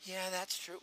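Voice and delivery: very soft reply; subdued callee. Shown as a demonstration. [name] confirming to the recruiter, soft and subdued. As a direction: answer in a small quiet voice. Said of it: "It has to do with the voices".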